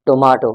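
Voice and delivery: "Tomato" as to-MAH-to; British pronunciation. Shown as a demonstration. Teacher answering her own question, British pronunciation. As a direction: say "Tomato" with a long AH. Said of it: "British English में".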